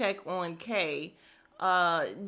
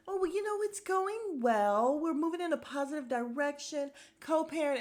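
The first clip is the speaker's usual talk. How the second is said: mock rosy cluelessness; 6 semitones higher than usual. Castmate brags about co-parenting, mockingly oblivious. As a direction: gush obliviously in a high, mocking voice.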